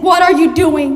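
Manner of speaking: ordinary delivery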